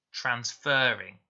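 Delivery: transferring said slowly, the fer drawn out long; teacher slowly sounding out transferring, fer held long and stressed. Shown as a demonstration. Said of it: "Is it making a long or a short sound?".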